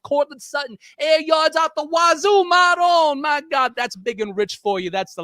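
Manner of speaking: put-on brags like a wiseguy in an Italian accent; mob Italian accent. Co-host welcoming a coach to the family, in a hammed-up mobster Italian accent. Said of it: "It's almost like you're Italian through and through".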